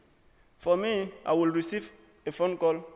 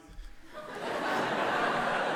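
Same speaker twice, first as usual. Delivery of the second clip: high airy burst of laughter; high breathy laugh; 4 dB quieter than usual. Real, not acted. To the audience, amused by the airport lady's directions, high laugh.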